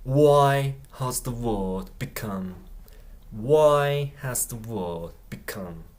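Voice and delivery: very slow, each word separate; slow repeat. Shown as a demonstration. Teacher repeating the clip for learners, very slow. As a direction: lay the question out slowly. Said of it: "slow teaching repeat".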